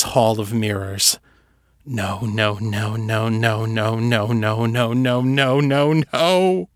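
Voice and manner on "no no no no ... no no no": rising panicked no. Acted. Seffi in the void, denial building to a cry.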